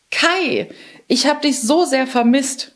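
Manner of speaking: put-on a girl overjoyed to hug her lost friend.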